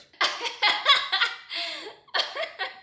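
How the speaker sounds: high, loud burst of laughter. Real, not acted. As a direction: crack up at your own joke. Laughs at own shout-out joke.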